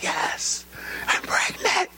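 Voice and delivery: breathless high whisper of joy; hushed wife. Retold or imitated. Wife confirming pregnancy to husband, high whisper. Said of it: "She's like".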